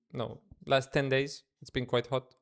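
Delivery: ordinary delivery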